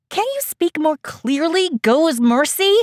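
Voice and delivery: loud, high, exasperated; angry retort. Acted. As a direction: snap back in anger.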